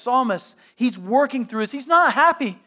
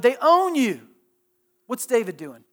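ordinary talk, nothing marked

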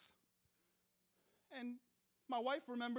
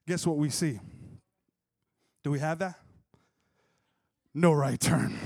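much louder, lower punchline boom; triumphant reveal; 8 semitones lower, 12 dB louder than usual. Real, not acted. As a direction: slam home the payoff. Punchline reveal, preacher build.

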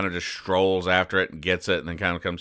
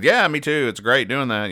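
higher, chirpy agreement; lightly voiced pig, keen to agree; eager comic pig; 6 semitones higher than usual.

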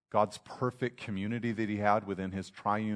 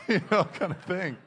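laughing; 8 semitones higher, 3 dB louder than usual. Real, not acted. Laughing after the mock quote.

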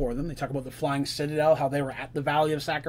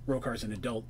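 usual speaking voice

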